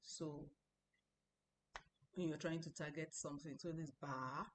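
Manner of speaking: ordinary delivery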